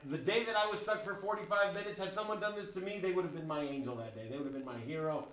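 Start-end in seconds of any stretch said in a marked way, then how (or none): none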